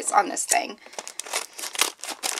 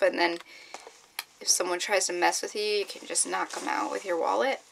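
ordinary talk, nothing marked

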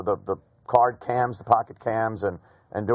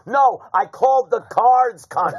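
high, loud, slow protest; retold indignant defence; 10 semitones higher, 5 dB louder than usual. Gambler defending himself to the floor, loud mock indignation. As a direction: spell it out indignantly, pitched up. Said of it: "I said".

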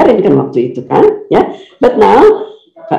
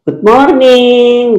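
"Good morning" high and long drawn-out; classroom greeting; 6 semitones higher, much slower than usual. Shown as a demonstration. Teacher mimics pupils greeting in classroom, "Good morning" in a high drawl. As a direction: stretch "morning" out like a class chorus. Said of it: "No, that's in classroom".

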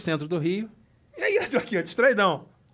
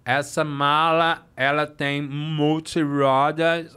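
very slow, low, laboured words; stroke-slurred speech; 6 semitones lower, much slower than usual. Retold or imitated. Luggage salesman to a browsing customer, stroke-slurred speech. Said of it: "teve um derrame".

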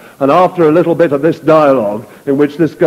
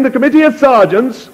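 raised voice; 9 semitones higher than usual. Acted. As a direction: fling it into the wind. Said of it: "he raised his voice".

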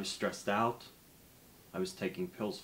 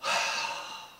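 deep calming sigh; 4 dB louder than usual. Acted. Deep breath demo.

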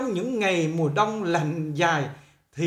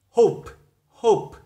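hope in an American accent, louder, with a rounded o; 3 semitones higher, 6 dB louder than usual. Shown as a demonstration. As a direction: model the American hope firmly. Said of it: "Giọng Mỹ người ta đọc là".

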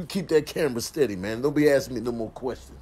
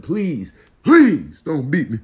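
mock pleading; 2 semitones higher, 9 dB louder, slower than usual. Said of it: "And I'm like".

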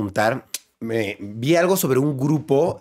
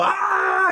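mock angry shout, much higher; imitating two angry voice actors shouting; angry yell. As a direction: bark out a cartoon furious yell. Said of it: "me los imagino los dos gritando".

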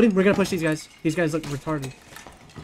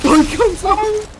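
excited drawn-out callout; 13 semitones higher, 5 dB louder, slower than usual. Real, not acted. Enemy emerging, excited reaction.

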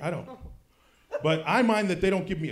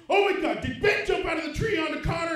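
alarmed wife; 10 semitones higher than usual. Retold or imitated. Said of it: "she says".

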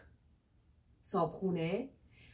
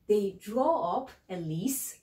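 slow, clear model sentence; slow teaching; much slower than usual. Shown as a demonstration. Teacher modeling lease sentence, slowly. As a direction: draw each word out for learners.

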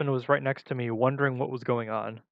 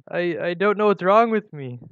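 acted sob, high and loud; sobbing; 4 semitones higher, 8 dB louder than usual. A narrator's reading. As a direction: stumble in, voice rising as if crying. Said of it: "I sobbed".

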